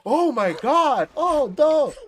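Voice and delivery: hammed-up high loud gasp, slow; mock a streamer who overreacts; overreacting streamer. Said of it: "he doesn't go".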